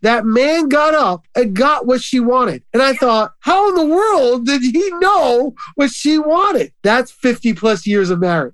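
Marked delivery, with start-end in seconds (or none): 3.4-6.7 high, slow, stretched-out bafflement; retold amazement. Pastor marvelling at a long-married couple, high and baffled. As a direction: marvel at it in a raised pitch.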